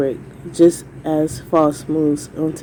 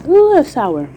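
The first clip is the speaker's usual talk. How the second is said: high squeal at a sour taste; puckered, startled ooh; sour candy reaction; 10 semitones higher, 5 dB louder than usual. Real, not acted. Just bit into sour candy.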